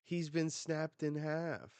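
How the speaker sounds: high, loud cheering; excited cheer. Retold or imitated. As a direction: celebrate the win out loud. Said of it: "they're like".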